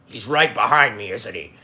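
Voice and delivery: low menacing character voice, fading to a murmur; Arbiter voice. Acted. Streamer redoing the line for chat in the Arbiter voice, low and ominous. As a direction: growl it like the Arbiter. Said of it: "I'll do it in the Arbiter voice".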